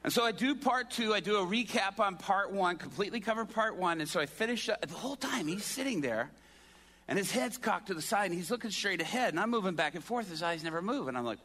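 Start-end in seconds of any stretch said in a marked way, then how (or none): none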